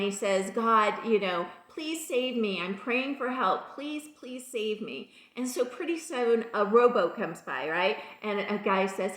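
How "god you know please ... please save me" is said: usual speaking voice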